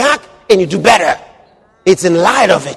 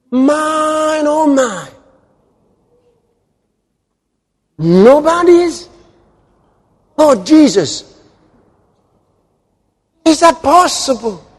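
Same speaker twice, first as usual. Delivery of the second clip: high, incredulous cries at a startling word; feigned astonishment, voice up high and drawn out; mock disbelief; 8 semitones higher, slower than usual. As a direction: play the scandalized believer, pitched high. Preacher feigns shock at scripture.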